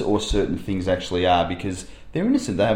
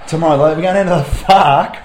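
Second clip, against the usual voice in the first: put-on excited kid; 5 semitones higher, 6 dB louder than usual. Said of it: "one of the kids has got a bit excited and gone".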